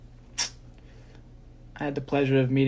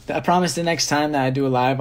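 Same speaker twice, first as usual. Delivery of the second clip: ordinary talk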